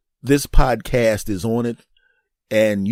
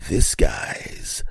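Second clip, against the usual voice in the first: low, slow, half-whispered cop line; Horatio Caine; 5 semitones lower, much slower than usual. Retold or imitated. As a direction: drop to a hushed, dramatic Horatio Caine delivery. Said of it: "Horatio Caine would ... he'd go".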